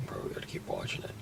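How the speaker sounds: hushed whisper so as not to be heard; breathy car stakeout whisper. Acted. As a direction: whisper it under your breath. Watching footage in car at night.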